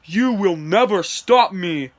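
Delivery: slow, put-on villain boast; drawn-out theatrical villain voice. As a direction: declare it slowly as a gloating villain. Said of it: "villain voice lines".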